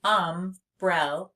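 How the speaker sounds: "Umbrella" in three slow separate beats; "Umbrella" spaced out for counting; split into syllables. Shown as a demonstration. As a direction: say um, brel, la for "Umbrella". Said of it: "I hear three syllables".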